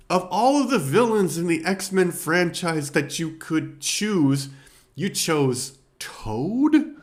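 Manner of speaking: high, loud, disbelieving, slowing on you chose Toad; rising outrage at a silly choice; incredulous rant. Real, not acted. Reviewer mocking the film's choice of Toad, incredulous. Ranting about Toad as villain.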